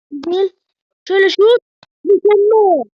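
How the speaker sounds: high, loud cheering; excited cheer. Real, not acted. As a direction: shout with joy at the shot. Cheering a good shot.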